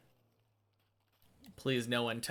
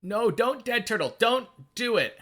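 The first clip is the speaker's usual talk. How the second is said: alarmed yelling; 11 semitones higher, 7 dB louder than usual. Real, not acted. Pleading with game character.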